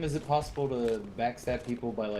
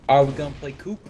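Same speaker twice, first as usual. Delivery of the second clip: loud call; 4 semitones higher, 11 dB louder than usual. Real, not acted. Loud question then answered.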